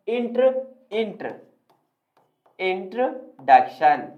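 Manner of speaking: इंट्र with no o, a short "truh", repeated; broken-down pronunciation. Shown as a demonstration. Teacher breaking the word up, syllable-by-syllable pronunciation. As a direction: say इंट्र apart, then slowly add duk-shun. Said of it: "इसका सही pronunciation होता है".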